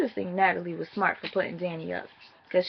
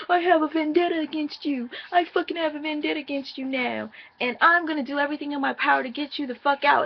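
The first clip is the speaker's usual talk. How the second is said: imitating a seething housemate, pitched higher; hammed-up threat, higher and quicker at the end; mock vendetta threat; 6 semitones higher than usual. Recapping Julie's threat to the rival, mock seething. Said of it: "'Cause Julie was like".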